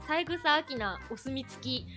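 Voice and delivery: usual speaking voice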